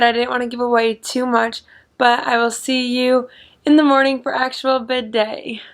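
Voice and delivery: normal talking voice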